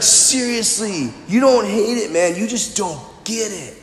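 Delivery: a hint of a mellow snowboarder bro; easy, laid-back dude delivery; surfer-dude talk. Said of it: "why they talk like that".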